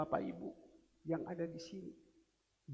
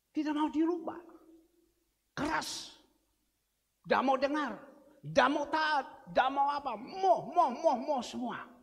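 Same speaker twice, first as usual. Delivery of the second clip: preaching fervently; 7 semitones higher, 5 dB louder than usual. Real, not acted. Preacher decries stubborn Israel.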